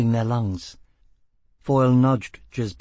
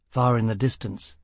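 ordinary delivery, nothing marked

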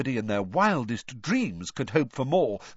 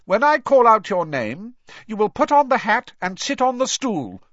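high, loud, projecting teacher voice; stern professor; 12 semitones higher, 8 dB louder than usual. A narrator's reading. Professor instructing the new first years, high and loud. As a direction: call out instructions across a hall. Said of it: "she told the first years".

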